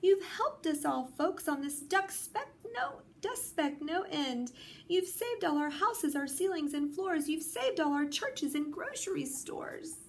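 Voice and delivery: very high small faraway voice; tiny speck voice. A narrator's reading. Who on the speck thanks Horton, tiny high voice. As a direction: pitch it way up like a tiny person on a speck. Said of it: "came the voice".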